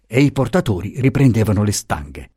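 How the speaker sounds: ordinary delivery, nothing marked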